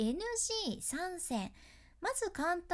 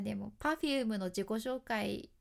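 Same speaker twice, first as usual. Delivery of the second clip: ordinary talk, nothing marked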